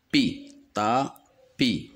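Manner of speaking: "Pi, tapis" slowly split into pi, ta, pi; syllable by syllable. Shown as a demonstration. Teacher isolating the I sound with P, syllable by syllable. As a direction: separate the syllables of "tapis" with clear gaps. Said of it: "la lettre I avec P".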